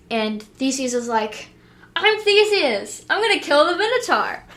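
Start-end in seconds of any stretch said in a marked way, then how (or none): 2.0-4.4 cocky hero boast. Retold or imitated. Cocky Theseus announces himself.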